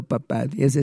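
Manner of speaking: normal talking voice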